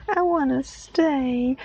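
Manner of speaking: high, slow, loud weepy plea; weeping. Acted. Junior pleading to stay, high weepy whine. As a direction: blubber it like a spoilt boy. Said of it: "wept Junior".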